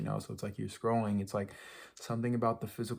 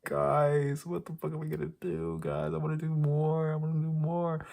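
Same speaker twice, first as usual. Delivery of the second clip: high, urgent appeal straight to the listeners; impassioned plea; 5 semitones higher, 3 dB louder than usual. Real, not acted. Vlogger begging viewers to do more, pleading. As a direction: plead with them, voice pushed up.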